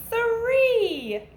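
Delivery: loud, high "Three!" called out; cheerful called-out count; excited answer. Acted. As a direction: announce the answer to the class with gusto. Teacher calls out answer.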